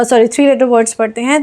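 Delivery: normal talking voice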